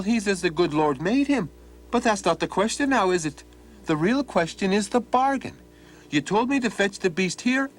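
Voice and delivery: high, innocent teasing lad; cheeky Donald. Acted. Donald teases the old man about the bargain, high and cheeky. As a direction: play dumb and rub it in.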